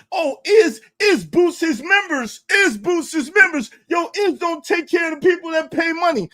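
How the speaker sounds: mock them as a crybaby, whiny and shrill-pitched; mocking whiny complaint. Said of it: "now you have a reason to cry".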